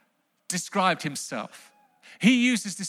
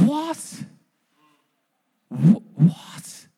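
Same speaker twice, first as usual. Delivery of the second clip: a stammering, shocked "what"; stunned disbelief; 3 semitones higher, much slower than usual. Pretend. Preacher acts a crowd member, stammering in shock. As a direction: sputter it like you can't believe it.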